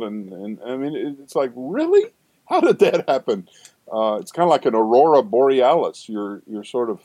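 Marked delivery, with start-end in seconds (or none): 1.6-3.5 leaps way up in pitch on really, astonished; real disbelief at something wonderful; high, wide-eyed surprise; amazed. Real, not acted. Marvelling at the film.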